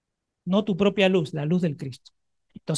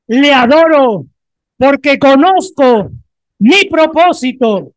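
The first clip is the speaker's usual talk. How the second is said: loud, slow, fervent declaration; shouted vow; 5 semitones higher, 8 dB louder, slower than usual. Acted. Preacher leads the congregation to declare to their neighbour, shouting slowly. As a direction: proclaim every line like a vow.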